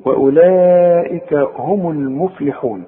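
"وأولئك هم المفلحون" slow, meem joined with damma; full recitation. Shown as a demonstration. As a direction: recite the whole phrase carefully. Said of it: "بس أنت بتتعلم".

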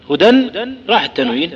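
usual speaking voice

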